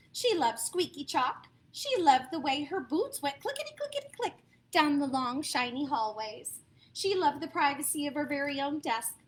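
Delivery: ordinary delivery, nothing marked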